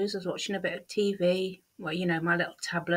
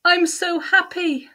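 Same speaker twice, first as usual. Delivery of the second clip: higher, louder and slow, gushing with joy; overjoyed agency lady; 10 semitones higher, 8 dB louder, slower than usual. Retold or imitated. Agency lady on the phone announcing good news, gushing higher and louder. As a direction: beam it out like the happiest news ever. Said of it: "the first thing she said to me".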